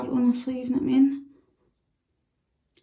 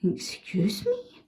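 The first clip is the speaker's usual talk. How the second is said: higher, startled disbelief at the door; mock surprise at an ex turning up at the door; mock shocked surprise; 4 semitones higher than usual. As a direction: act caught off guard by the visitor. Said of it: "this is you opening the door like that".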